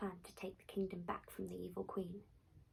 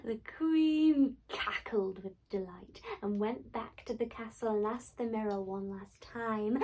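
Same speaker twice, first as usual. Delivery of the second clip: plain reading voice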